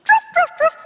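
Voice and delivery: high yappy puppet barks; puppy barks. Acted. Puppet dog waking up for the kids, high happy barks. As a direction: bark like an eager little dog.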